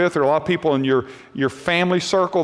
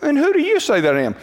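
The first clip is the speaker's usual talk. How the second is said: quick, higher-pitched offhand question; breezy, unemphatic discussion tone; casual reading; 8 semitones higher, faster than usual. Shown as a demonstration. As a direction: toss the question out lightly in one run. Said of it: "That's how we kinda read it".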